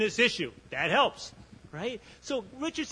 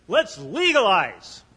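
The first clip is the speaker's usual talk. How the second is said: slow, louder announcement; bold declaration; 7 dB louder, slower than usual. Retold or imitated. Republican governor announcing legalization, slow and louder. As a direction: spell it out like a bold proclamation. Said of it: "he pops out and he goes".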